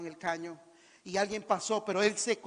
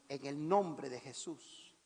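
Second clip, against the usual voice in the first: solemn; 7 semitones lower, 7 dB quieter, slower than usual. Acted. Continues command in lower voice.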